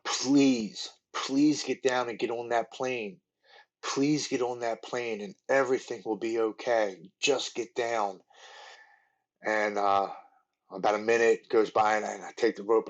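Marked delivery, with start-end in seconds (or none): 0.0-8.2 slow, drawn-out imploring plea; gently beg, stretching out each phrase; retold pleading. Wife imploring husband to get down and on that plane, pleading.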